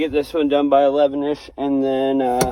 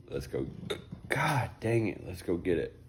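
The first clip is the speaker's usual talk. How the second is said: normal talking voice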